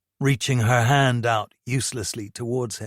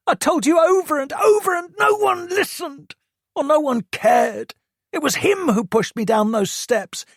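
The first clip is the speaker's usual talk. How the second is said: high-pitched, loud furious rant; angry teen rant; 12 semitones higher, 4 dB louder than usual. A narrator's reading. Hurt son lists Toby's abuse to mum, furious and high. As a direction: pour out every grievance, voice pitched up. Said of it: "he exploded".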